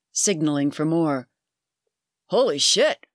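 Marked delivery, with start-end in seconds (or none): 2.3-3.1 erupting. Acted. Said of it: "erupted Bud".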